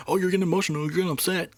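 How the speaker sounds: mock his dismissive tone; mocking whiny imitation. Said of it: "so he's just trying to be like".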